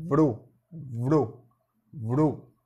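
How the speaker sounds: "vro" repeated, each one hit clearly as a single syllable; stressed syllable drill. Shown as a demonstration. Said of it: "nessa sílaba temos o V e o R".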